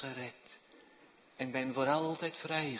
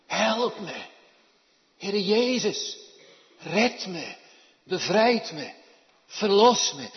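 loud, high desperate plea; crying out for help; 8 semitones higher, 13 dB louder than usual. Acted. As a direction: call out to Jesus to be saved. (luid en hoog om redding roepen)

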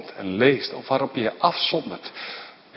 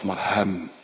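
normal talking voice

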